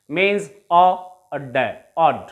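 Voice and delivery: ऑ and ड said apart, then joined as ऑड; sounds split then blended. Shown as a demonstration. Teacher to students, building the rhyme of god: ऑ, ड, then ऑड blended. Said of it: "इस ऑड को हम कहेंगे rhyme".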